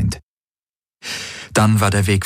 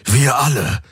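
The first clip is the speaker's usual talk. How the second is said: dark-timbred man, urgent appeal; Hawks, earnest pleader; 4 semitones higher than usual. A narrator's reading. Hawks urging Tari to surrender, earnest plea. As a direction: plead earnestly with the enemy guard.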